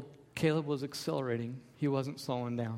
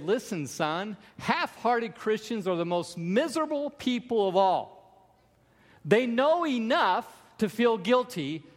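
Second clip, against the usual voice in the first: rising, louder fatherly exhortation; preaching father's warning; 5 semitones higher, 4 dB louder than usual. Retold or imitated. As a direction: build it higher and louder like a father warning his son. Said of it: "He said".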